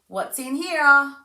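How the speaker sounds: high, slow, sing-song wonder; slow teasing sing-song for a toddler; playful demo to child. Shown as a demonstration. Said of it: "you can cover it and go".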